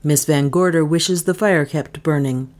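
ordinary delivery

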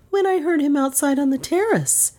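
high, ready, honest answer; prompt and truthful. A narrator's reading. Niece answering the detective about the revolver, promptly and truthfully. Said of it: "said Dale promptly and truthfully".

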